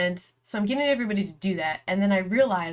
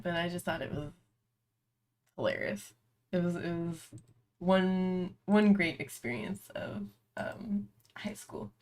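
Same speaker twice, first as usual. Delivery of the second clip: trailing down into a softer whisper; growing softer to a whisper; fading; 6 dB quieter than usual. Real, not acted. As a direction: let it fade out as laughter takes over.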